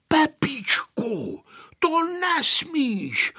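high, long-drawn warning; slow, high scolding of a playmate; threatening warning. A narrator's reading. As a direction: stretch the name out as a warning. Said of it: "hrozil mu Vojta".

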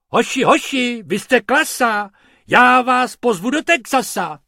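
loud, high cheering call; calling out cheerfully. Acted. Mr Pumpernagel calls out praise to the rabbits, loud and high. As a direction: cheer the lads on from afar. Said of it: "pan Pumpernagel volal".

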